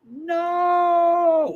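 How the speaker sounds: long, high, held wail of no; dismayed. Real, not acted. Viewer reacting to the race replay, dismayed. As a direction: stretch one high note of protest.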